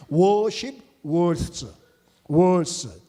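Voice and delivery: English words with Korean pronunciation; Korean-accented English. Real, not acted. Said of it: "본토 발음대로 못하지만". (한국식 영어 발음)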